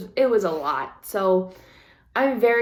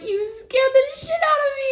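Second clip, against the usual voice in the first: very high-pitched, exasperated reenactment; retold frightened scolding; 4 dB louder than usual. Wife telling off husband who scared her, high-pitched scolding. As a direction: scold him in a squeaky, worked-up pitch.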